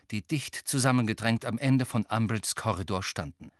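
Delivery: ordinary delivery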